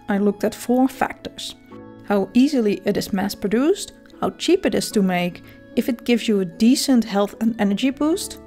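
usual speaking voice

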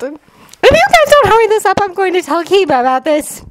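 put-on high-pitched girl making a mild threat; threatening Sakura. A narrator's reading. Sakura threatens to tell on the boys, high voice. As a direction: warn them in a high character voice. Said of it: "Sakura threatened".

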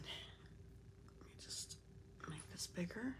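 whispered under the breath, slow at first; whispered aside. Real, not acted. Host adjusting her livestream screen, murmuring to herself in a whisper. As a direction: quietly mutter it to yourself off-mic.

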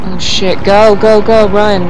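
loud frantic go go go; panicked urging. Real, not acted. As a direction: yell at the screen in panic. Panicked urging during chase.

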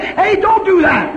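high, loud alarmed shout of protest; startled shout. Retold or imitated. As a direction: yell it out to stop her. Startled by women in sauna.

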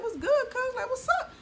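very high laughing "What's good, cuz?"; mock squaring up, laughing; mock confrontation. Shown as a demonstration. Beefing with somebody demo.

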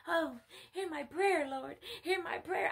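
high-pitched imitated begging; mock tearful plea. Follower begging the Lord to hear, mock pleading. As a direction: mimic someone wailing a prayer, lightly.

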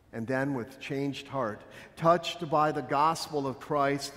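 ordinary talk, nothing marked

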